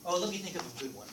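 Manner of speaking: ordinary delivery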